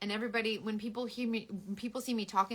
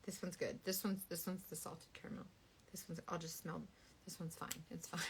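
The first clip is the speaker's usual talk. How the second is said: quiet whisper; 4 semitones lower, 15 dB quieter than usual. Real, not acted. Quiet sniffing.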